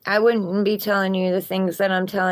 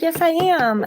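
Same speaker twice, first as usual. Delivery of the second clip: insistent retort; 10 semitones higher than usual. Retold or imitated. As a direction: snap back at mom, half-playful. Retold argument with mom about accent.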